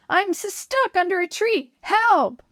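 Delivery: high panicked calling; shouting for help. A narrator's reading. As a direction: yell desperately into the woods. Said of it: "he shouted".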